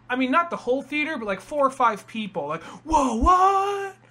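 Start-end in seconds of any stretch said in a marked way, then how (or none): 2.8-4.0 high, louder mock gasp of surprise; hammed-up audience shock; mock surprised audience. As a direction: play the stunned moviegoers. Imitating surprised audience members.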